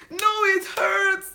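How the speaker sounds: high, slow, laughing through fake sobs; mock crying. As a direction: whimper it like a hammed-up crybaby while the hair hurts. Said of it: "I was crying".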